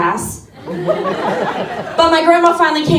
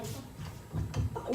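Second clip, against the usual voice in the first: whispered aside; 23 dB quieter, much slower than usual. Retold or imitated. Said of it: "I'm like".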